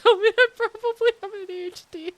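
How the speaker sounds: amused at own situation; laughing admission. Real, not acted. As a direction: admit it while giggling.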